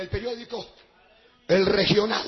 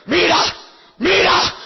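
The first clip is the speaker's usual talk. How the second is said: loud, raised, insistent call; urgent command; 5 semitones higher, 9 dB louder, slower than usual. Retold or imitated. God urges the preacher to look, loud and insistent. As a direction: punch it out, urging him to look. God's urging, building to shouts.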